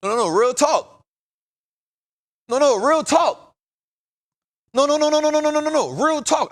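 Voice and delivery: high, loud burst of no no no; excited protest. Real, not acted. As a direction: cut them off with rising insistence. Excited insistence, laughing protests.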